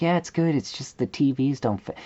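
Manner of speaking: high, sugary politeness; high, chirpy, mock-polite answer; overly polite reply. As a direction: act sweetly polite while hinting at a complaint. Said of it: "I'm like".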